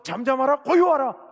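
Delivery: loud, high order to the storm; ringing preacher's imperative; commanding rebuke. Acted. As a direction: command it with full authority, building on the last word. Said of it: "라고 말씀하시면서".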